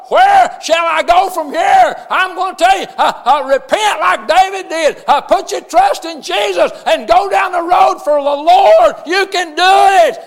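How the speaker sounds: loud, rhythmic preaching with shouted peaks. Acted. Pastor preaching to his congregation, shouting at full force. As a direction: preach it hard to the church, drawing out the refrain.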